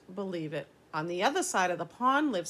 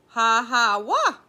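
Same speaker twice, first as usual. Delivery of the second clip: loud sobbing ha ha wah, rising much higher; mock sobbing wail; 3 semitones higher, 11 dB louder, slower than usual. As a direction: sob out loud like a child. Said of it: "said the voice from across the pond".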